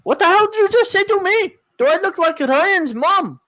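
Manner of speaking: indignant shrill retort; angry high voice. Acted. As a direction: snap back, high and fast. Acted angry confrontation.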